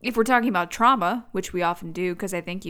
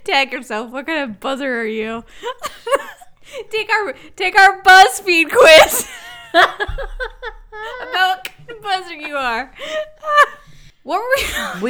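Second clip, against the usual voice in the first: joking through laughter, higher pitched; giggling; 10 semitones higher, 8 dB louder than usual. Real, not acted. Co-host pitches a silly quiz to her partner, giggling. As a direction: crack up while pitching the bit.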